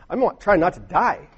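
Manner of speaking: ordinary talk, nothing marked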